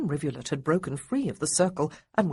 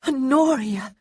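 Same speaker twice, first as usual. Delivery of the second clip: loud, high cry of relief at a name; excited exclamation; 8 semitones higher, 7 dB louder than usual. Acted. Sarah greeting her drenched cousin, a loud high exclamation. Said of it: "Sarah exclaimed".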